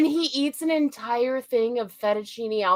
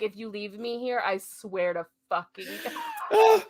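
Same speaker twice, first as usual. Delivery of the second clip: imitated threat; 4 semitones lower, 3 dB quieter than usual. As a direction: threaten under your breath, in imitation. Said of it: "with that very specific tone of voice".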